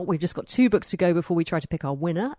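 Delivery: normal talking voice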